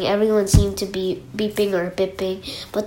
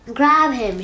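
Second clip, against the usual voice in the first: higher mock shout; sharp order to seize someone; storybook shout, moderately raised; 5 semitones higher, 4 dB louder than usual. A narrator's reading.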